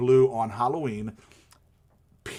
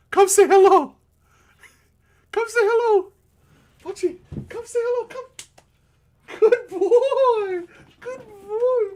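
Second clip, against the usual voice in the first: high pet voice; 6 dB louder than usual. Real, not acted. Owner coaxing his dog to greet chat, high squeaky baby talk. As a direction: squeal it to the dog like it's a puppy.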